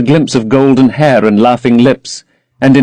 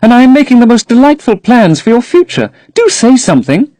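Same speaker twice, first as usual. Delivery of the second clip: put-on higher, young girl's plea; lightly raised girlish protest; Sybil crying out; 12 semitones higher, 3 dB louder than usual. A narrator's reading. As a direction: voice the eager young actress.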